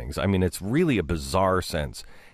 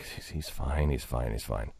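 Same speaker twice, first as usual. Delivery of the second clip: softer, lower self-reassurance; muttering to himself; 10 semitones lower, 8 dB quieter than usual. Retold or imitated. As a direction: mumble it to convince yourself. Nervously reassuring himself in retelling.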